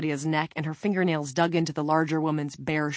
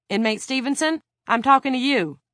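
higher, raised officer's demand; stern calling out; 8 semitones higher, 6 dB louder than usual. A narrator's reading. Officer to an inmate who ignores her, stern call. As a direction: demand attention from down a corridor.

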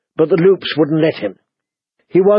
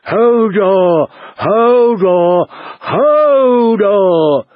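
high, drawn-out roar of panic; acted roar, voice pushed upward; Hodor roaring; 5 semitones higher, much slower than usual. A narrator's reading. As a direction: bellow it long, pitch climbing on the last. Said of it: "with Hodor roaring".

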